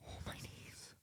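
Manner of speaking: whispered wince of pain. Real, not acted. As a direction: breathe it out, knees aching. Stiff knees after sitting.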